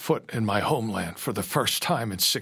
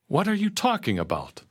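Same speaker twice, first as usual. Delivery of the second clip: high-pitched, disbelieving question; insist, pitch jumping up in disbelief; insistent; 9 semitones higher than usual. A narrator's reading. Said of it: "I insisted".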